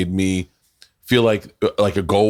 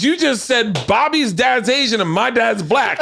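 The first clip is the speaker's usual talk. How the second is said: high, drawn-out mock outrage; imitated indignant protest; 14 semitones higher than usual. Donnell arguing with the psychic, imitated outrage. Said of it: "He goes".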